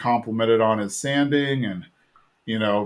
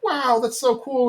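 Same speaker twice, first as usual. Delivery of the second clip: put-on much higher, amazed kids' wow; awed kids; 11 semitones higher than usual. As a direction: gush like an impressed kid. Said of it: "they're all like".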